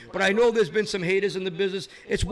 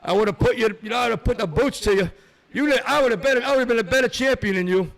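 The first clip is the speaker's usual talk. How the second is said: loud brash promo voice, louder all through; boastful wrestler promo, louder and higher; wrestling trash talk; 3 semitones higher, 4 dB louder than usual. Acted. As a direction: cut a promo on your rival, brother.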